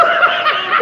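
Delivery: high burst of laughter. Real, not acted. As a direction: laugh out at the memory. Laughing at own dream story.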